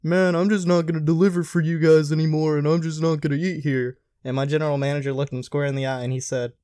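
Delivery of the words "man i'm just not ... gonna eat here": raised, louder huffy customer voice; mock indignant customer. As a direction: play the fed-up driver quitting in a huff. Customer storms off, retold.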